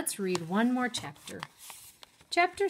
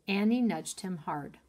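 ordinary delivery

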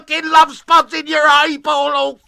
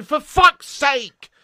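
ordinary talk, nothing marked

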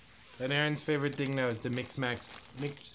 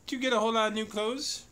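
high, loud, fast delighted question; excited; 10 semitones higher, 5 dB louder, faster than usual. Real, not acted. Gasps at gifts for toddler.

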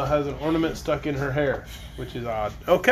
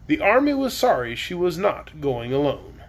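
usual speaking voice

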